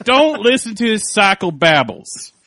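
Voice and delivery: loud, slow put-on Maine accent; hammed-up New England lawman's accent. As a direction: belt out a drawn-out Maine warning. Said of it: "why this is the accent for".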